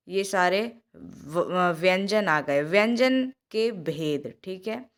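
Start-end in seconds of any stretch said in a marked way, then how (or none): none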